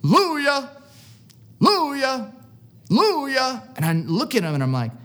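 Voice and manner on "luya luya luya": high, ringing retold holler; screamed call. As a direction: imitate a pastor hollering down the cell block. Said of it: "he starts screaming".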